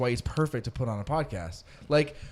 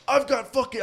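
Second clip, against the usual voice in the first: put-on Mitchell's accent; 10 semitones higher, 3 dB louder than usual. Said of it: "I can't do his accent".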